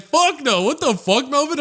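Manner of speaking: high-pitched imitation of a teasing girl; playful squeaky rejection; joking refusal. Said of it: "like, joking".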